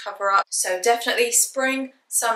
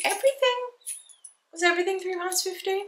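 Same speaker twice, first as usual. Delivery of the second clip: surprised; 11 semitones higher, 3 dB quieter, faster than usual. Real, not acted. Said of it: "amused at repeated price".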